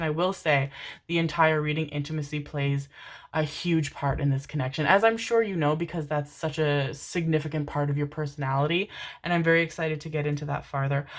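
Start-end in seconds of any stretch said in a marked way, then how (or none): none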